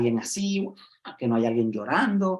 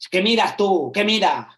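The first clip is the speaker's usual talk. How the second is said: put-on loud, higher, confrontational teen voice; aggressive teen challenge; 7 semitones higher, 7 dB louder than usual. A teen confronting someone who looked at him, loud and defiant. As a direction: throw the question back like a provoked kid.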